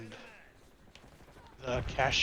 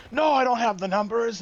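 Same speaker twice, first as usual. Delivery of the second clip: angry customer; 7 semitones higher, 6 dB louder than usual. Retold or imitated. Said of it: "he's so mad".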